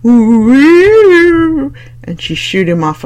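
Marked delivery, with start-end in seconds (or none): 0.0-1.7 high, loud ghostly ooh; hearty spooky ooh. Acted. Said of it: "accompanied with a hearty".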